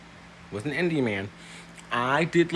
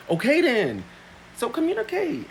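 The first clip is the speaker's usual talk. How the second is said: much higher, emphatic; pleased punchline; triumphant; 14 semitones higher than usual. Real, not acted. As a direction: declare victory. Triumphant wrap-up.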